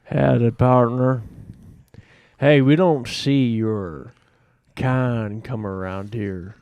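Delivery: slow western drawl, deliberate; cowboy. Acted. Cowboy warns a slave off the bar, slow menace. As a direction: play an unwelcoming saloon cowboy, drawn out. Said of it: "I'm a cowboy".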